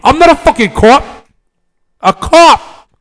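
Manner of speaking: high, loud, escalating mock outrage; mock shouting. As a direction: build to a comic shout on the last word. Escalating comic shouting over others.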